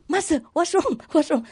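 worried disciple. Retold or imitated. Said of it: "He come running to me".

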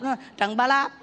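"Trần Ba Lạp" with wrong tones, high; mock the wrong-tone "Trần Ba Lạp"; mangled name. Shown as a demonstration. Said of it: "Trần Bá Lập thì nói Trần Bá Lập".

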